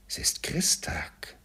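hushed, slow, near whisper; to himself. A narrator's reading. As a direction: murmur it slowly to yourself in wonder. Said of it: "sagte Scrooge zu sich selbst". (leise zu sich selbst)

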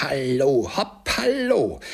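high, loud, drawn-out hallo; joyful whoop. A narrator's reading. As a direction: whoop it out in sheer joy. Scrooge whoops for joy. (jubelnder Freudenruf)

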